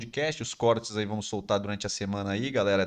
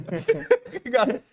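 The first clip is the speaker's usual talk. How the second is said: loud laugh; 13 semitones higher, 5 dB louder than usual. Real, not acted. Laughing after the sung joke.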